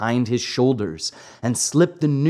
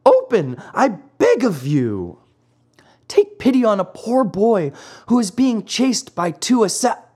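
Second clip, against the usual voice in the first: high, pleading puppet cry; desperate begging; 9 semitones higher, 3 dB louder than usual. Acted. Poor boy chased by assassins begs the maiden, desperate. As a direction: beg for rescue, pitched up, cut off mid-word. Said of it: "cried Pinocchio".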